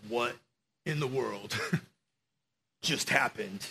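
low, hushed bewilderment; quiet awe with halting pauses; stunned disbelief. Acted. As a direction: say it softly, as if dumbstruck. Stunned disciples after storm stops.

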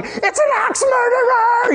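drawn-out, very high-pitched shriek; freaking-out mom. Acted. Mom guessing again what's at the door, freaking out. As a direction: stretch it into a hammed-up wail of terror. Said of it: "freaking out".

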